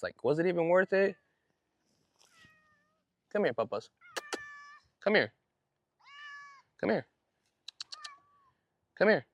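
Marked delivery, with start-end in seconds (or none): 3.3-9.3 high, coaxing call to a pet; calling the cat. Real, not acted. Owner calling his outdoor cat Papas, high and coaxing. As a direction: sing out to coax the cat closer.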